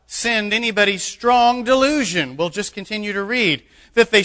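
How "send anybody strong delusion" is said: loud, slowed, incredulous objection; skeptical listener. Retold or imitated. Preacher voicing a doubter's objection, loud and incredulous. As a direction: voice the doubting churchgoer, drawn out and loud. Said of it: "You say".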